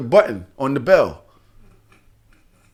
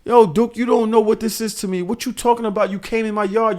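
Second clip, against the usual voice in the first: higher-pitched, exasperated rant; heated scolding in a raised pitch; indignant retold telling-off; 6 semitones higher than usual. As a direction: act out cursing out a careless courier. Retells cursing out the driver.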